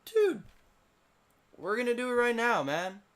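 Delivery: high, loud bro voice; hammed-up high, loud team hype; mock team bravado. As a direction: yell it like a hyped-up teammate. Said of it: "Revelstoke just was like".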